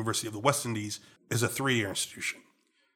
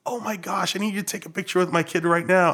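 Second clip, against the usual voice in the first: higher-pitched mom voice; gushing mother, put on; imitating excited mother; 6 semitones higher than usual. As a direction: play an eager parent asking a favor. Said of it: "this mother and this tiny child".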